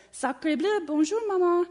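high, loud French duckling voice; baby French duck. Acted. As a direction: greet mama as a French-accented baby. Newborn French duckling greets mama.

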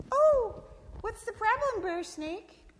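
high-pitched concerned possum voice; Sister Possum. Acted. Possum to snake in the hole, high concerned question. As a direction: ask in a high little animal voice.

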